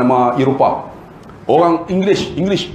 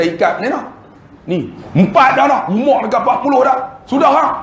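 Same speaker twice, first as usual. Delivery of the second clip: higher, insistent wife; wife pleading; 8 semitones higher than usual. Retold or imitated. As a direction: press her husband to agree. Isteri minta ikat peranakan. (isteri mendesak suami, suara tinggi)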